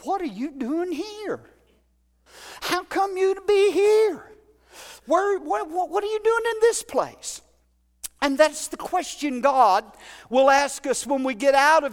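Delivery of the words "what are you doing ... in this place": rising, searching questions, pitched higher; God calling him out, insistent; God asks the runaway prophet what he is doing there, searching and rising; voice of God questioning. Retold or imitated. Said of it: "and, and say to me".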